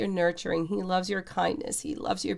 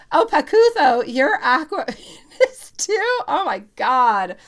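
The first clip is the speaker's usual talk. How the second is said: much higher, louder, a laugh breaking in; delighted surprise; 7 semitones higher, 6 dB louder than usual. Real, not acted. Greeting a viewer's sign with a delighted, high squeal.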